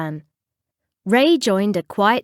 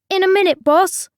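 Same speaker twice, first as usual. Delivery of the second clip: high, raised call back; higher player voice; 10 semitones higher, 4 dB louder, faster than usual. A narrator's reading. Captain answering his coach, higher player voice.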